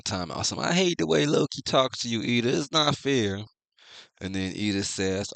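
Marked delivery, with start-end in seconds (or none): 0.6-3.4 put-on much higher, louder whiny child voice; mock a tearful little kid complaining; squeaky upset child pleading; whiny crying kid. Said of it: "some random little bitch crying".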